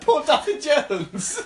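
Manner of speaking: high squeal through laughter; laughing. Real, not acted. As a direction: laugh the words out, slow. Laughing at the imagined beating.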